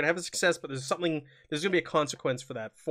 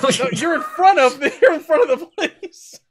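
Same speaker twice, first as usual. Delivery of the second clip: laughing; 11 semitones higher, 10 dB louder than usual. Real, not acted. GM laughs at player's plan.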